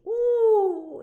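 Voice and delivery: high, loud wondering ooh; a child's gasp of wonder; amazed. A narrator's reading. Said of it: "The small person was amazed".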